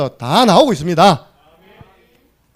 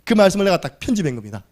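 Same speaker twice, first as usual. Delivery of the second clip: normal talking voice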